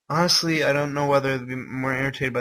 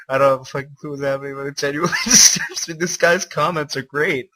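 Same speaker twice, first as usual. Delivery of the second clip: laughing disbelief; 3 semitones higher, 9 dB louder than usual. Real, not acted. Disturbed, laughing at fanfic.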